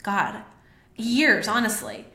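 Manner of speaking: emotional. Real, not acted. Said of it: "this makes me a little bit emotional".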